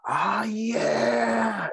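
imitating a blissful, long-drawn high cry; retold eager acceptance. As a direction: stretch it out high, mimicking someone relishing it. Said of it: "And Jamal was like".

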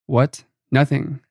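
ordinary delivery

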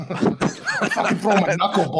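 high-pitched, laughing through the words. Real, not acted. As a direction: crack up while joking. Joking along with co-hosts, laughing.

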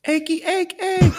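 high, loud rooster crow, ending in a shout; Thai rooster call. Shown as a demonstration. Asked for a rooster imitation, he crows high and loud. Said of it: "that's what they say in Thailand".

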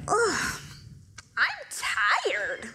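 lightly voiced stretching husband; a hint of a groggy husband waking up; mock tired husband groan. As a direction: yawn it out like a lazy husband. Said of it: "You're like".

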